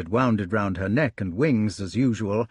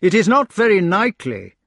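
wry complaint; 8 semitones higher, 7 dB louder than usual. Acted. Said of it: "said Lancelot with a grimace".

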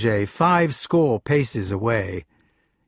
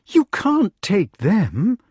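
lady objecting; 9 semitones higher, 3 dB louder, much slower than usual. A narrator's reading. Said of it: "interrupted the lady".

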